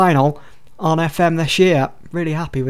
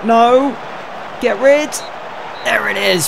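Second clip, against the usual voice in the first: anxious shout; 7 semitones higher than usual. Real, not acted. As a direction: shout it clear in a panic. Corner late in tight game.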